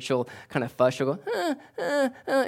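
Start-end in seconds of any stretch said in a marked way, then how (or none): 1.3-2.4 put-on high fussing uh uh uh of a scared baby; imitating a fussy infant's whimper; baby fussing imitation. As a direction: mimic a baby fussing, much higher. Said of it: "she does this thing when she's scared".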